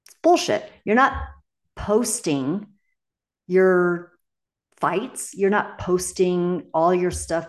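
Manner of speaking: exasperated burst against fake perfection; indignant outburst. Real, not acted. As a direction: call it out, fed up. Scoffing at perfect social-media lives.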